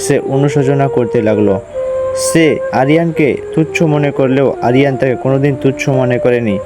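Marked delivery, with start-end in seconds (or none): none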